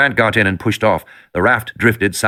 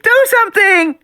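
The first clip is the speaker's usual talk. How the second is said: even higher, louder desperate scream; girl screaming louder; 4 dB louder, slower than usual. A narrator's reading. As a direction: scream it again, more desperate. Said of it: "Alexis screamed".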